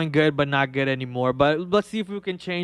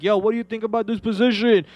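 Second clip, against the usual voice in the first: higher-pitched mock yell across to a colleague; mock scream; 8 semitones higher, faster than usual. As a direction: call out urgently, imitating your own pestering. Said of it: "just scream at him, be like".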